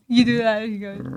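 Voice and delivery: normal talking voice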